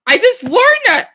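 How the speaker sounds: high, loud wail of frustration; frustrated outburst. Real, not acted. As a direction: blurt it out high, annoyed at yourself. Annoyed at forgetting a fresh word.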